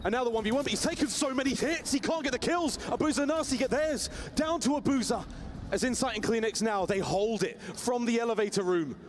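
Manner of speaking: rising, urgent commentary; excited play-by-play. Real, not acted. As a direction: build the tension call by call. Live play-by-play in a clutch.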